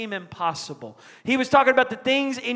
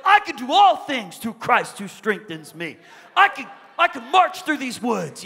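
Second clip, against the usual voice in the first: bold declaration; 3 semitones higher, 5 dB louder than usual. Retold or imitated. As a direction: proclaim it like a child pumping himself up. Boy declares his faith boldly.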